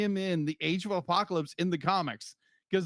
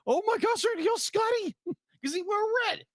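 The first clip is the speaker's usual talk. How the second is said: put-on excited kid gasp; 12 semitones higher than usual. Retelling childhood shock.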